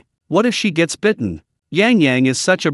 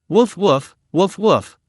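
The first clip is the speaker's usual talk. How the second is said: a lightly voiced barking teddy dog, slightly higher; 3 semitones higher, slower than usual. A narrator's reading. Teddy bear barking wildly at Yang Yang, mild yapping. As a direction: bark it out like a small angry dog. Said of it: "barking wildly at Yang Yang".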